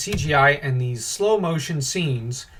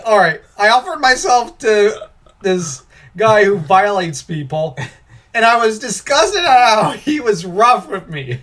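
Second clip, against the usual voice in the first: lightly hammed-up, raised voice; mock complaint; 5 semitones higher, 6 dB louder than usual. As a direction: play an indignant complainer, loud and high. Voicing an absurd complainant as analogy.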